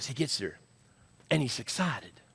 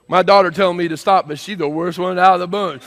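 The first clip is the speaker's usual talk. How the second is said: raised, forceful pulpit voice; loud preaching; 5 semitones higher, 12 dB louder than usual. Real, not acted. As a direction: tell it loudly to the whole room. Preaches at the congregation.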